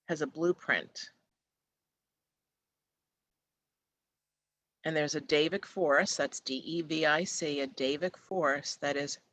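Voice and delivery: guided meditation. Acted. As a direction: lull them toward peace, one step at a time.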